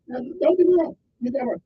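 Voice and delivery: ordinary talk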